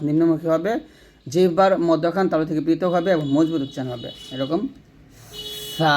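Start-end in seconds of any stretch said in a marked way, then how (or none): 5.8-5.9 সা said thin, breath flowing out; light letter demo. Shown as a demonstration. Teacher modelling a light সা with flowing breath. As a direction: let the air run through সা. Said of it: "পাতলা করে".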